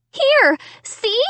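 high urgent offer; crying out. A narrator's reading. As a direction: push it at them urgently. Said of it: "I cried".

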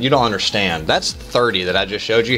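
ordinary delivery

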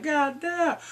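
high, loud mock astonishment; amazed exclamation. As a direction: cry it out, impressed. Amazed reaction, imitated.

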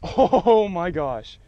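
high, loud laugh into "oh"; real giddy disbelief; laughing excitement. Real, not acted. Angler laughing at the huge catch, excited.

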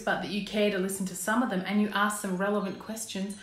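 usual speaking voice

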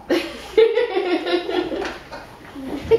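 high giggling burst; real laughter. Real, not acted. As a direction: crack up mid line. Breaks up mid reading.

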